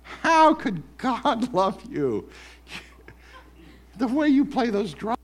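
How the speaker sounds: high, slow, jeering accuser; mock the drummer as the accuser would; sneering accuser teasing the drummer; accuser's voice. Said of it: "the accuser's voice".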